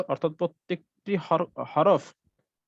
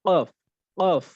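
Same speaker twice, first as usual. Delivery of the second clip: ordinary talk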